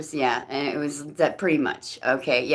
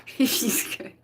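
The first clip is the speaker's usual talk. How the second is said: laughing; 8 semitones higher, 7 dB quieter than usual. Real, not acted. Laughing after joke.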